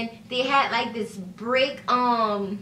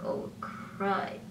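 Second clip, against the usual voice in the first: whispered "oh crud", much softer; hushed dismay at the trouble; retold quiet dread; 4 semitones lower, 11 dB quieter than usual. Realizing trouble.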